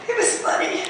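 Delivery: rising into high-pitched delight; delighted amusement. Acted. Woman recalling her playful discovery for an audience, delighted.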